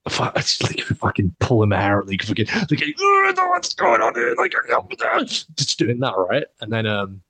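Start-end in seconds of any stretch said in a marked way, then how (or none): 3.0-4.5 high, strained mock rage; enraged. Shown as a demonstration. Showing his co-host what enraged looks like, high mock fury. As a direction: act out a drawn-out squawk of fury. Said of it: "What does enrage you look like?".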